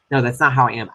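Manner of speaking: ordinary delivery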